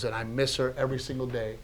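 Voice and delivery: soft, subdued, moved retelling; emotional. Real, not acted. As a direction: quote his grief quietly and tenderly. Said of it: "I'm getting emotional right now thinking".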